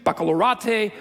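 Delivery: baccalaureate with an "AH-tay" ending; mispronounced baccalaureate. Real, not acted. Speaker keeps up the joke, baccalaureate ending "AH-tay". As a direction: say baccalaureate as "back-a-lor-AH-tay". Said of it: "that's how it's pronounced".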